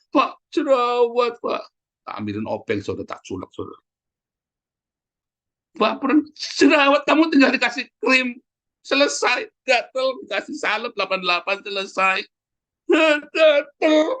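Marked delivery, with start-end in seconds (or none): 0.1-1.6 high, slow drawn-out mock whine; whining member. Member complaining of a pimple to the pastor, exaggerated whine. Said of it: "Anda datang sama saya". 5.8-14.2 high-pitched hammed-up complaint, louder at the end; mock whiner. Pastor mimicking a member fussing over itch cream, mock whine.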